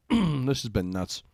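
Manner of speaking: usual speaking voice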